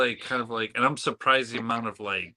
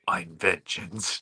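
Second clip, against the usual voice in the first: mock Batman growl; much slower than usual. Bale's Batman line, mocked.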